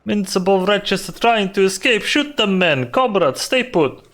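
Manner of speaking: put-on Russian gang boss accent. Boss keeping his comrade on the cage, Russian accent. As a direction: give orders in a Russian accent. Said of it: "have to cosplay Russian accent".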